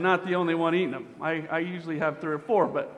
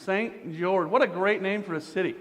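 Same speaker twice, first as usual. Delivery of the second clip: normal talking voice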